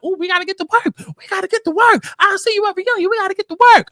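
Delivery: very high-pitched mocking chant; mock yelling. Host mocks the girls yelling on the van, high-pitched imitation. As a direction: mimic their excited yelling in a high put-on voice. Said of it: "sitting there yelling out".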